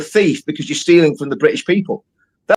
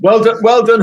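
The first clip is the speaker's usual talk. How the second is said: mock congratulations, pitched higher; sarcastic praise; 6 semitones higher, 4 dB louder than usual. Real, not acted. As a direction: praise it with heavy irony. Mocking the exposed prank.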